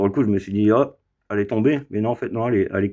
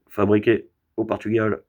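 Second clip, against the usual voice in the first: ordinary delivery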